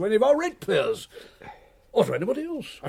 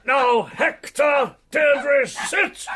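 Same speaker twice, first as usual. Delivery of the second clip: slow, loud dog commands; drawn-out shout at the dogs; calling a lost pet; 7 semitones higher, 5 dB louder, much slower than usual. Acted. Ordering his dogs to sit.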